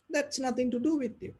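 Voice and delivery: usual speaking voice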